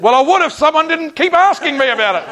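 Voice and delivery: high, loud mock exasperation; comic retold yell of frustration; exasperated outburst. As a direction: blurt it out, fed up with pestering. Acted exasperated comeback to pestering friend.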